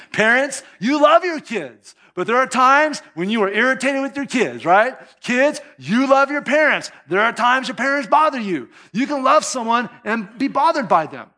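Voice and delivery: loud, high, driving sermon voice; fervent preaching. Acted. Preacher urging the congregation, loud and driving. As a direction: press the point home to the congregation.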